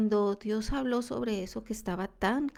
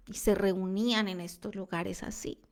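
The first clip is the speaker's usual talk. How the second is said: ordinary talk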